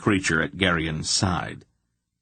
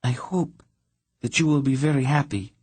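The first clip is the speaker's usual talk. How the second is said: higher, brittle politeness; false good wishes; 7 semitones higher than usual. A narrator's reading. Princess lying to Geryon, brittle and false. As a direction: say it sweetly without meaning it. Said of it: "she lied to him".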